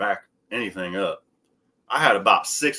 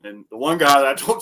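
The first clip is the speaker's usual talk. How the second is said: ordinary talk, nothing marked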